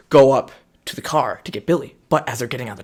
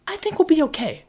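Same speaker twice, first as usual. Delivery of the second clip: mock high voice. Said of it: "And it's like".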